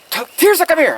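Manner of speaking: high, loud yell of her name; yelling at the dog. Retold or imitated. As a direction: shout her back from the chicken. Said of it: "and I'm yelling".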